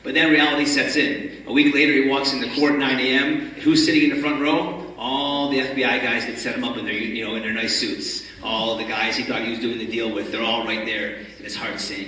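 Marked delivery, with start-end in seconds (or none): none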